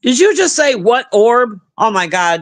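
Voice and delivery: normal talking voice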